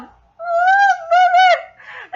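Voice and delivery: high drawn-out squeal; emotional wail. Real, not acted. Overcome reacting to the story.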